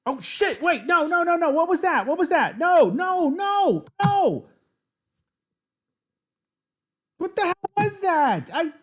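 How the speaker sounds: shrieking high and loud in disbelief; panicked screaming. Real, not acted. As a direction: scream at the misclick. Accidental mouse-slip chess blunder.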